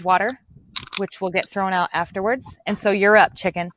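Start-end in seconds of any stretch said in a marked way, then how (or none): none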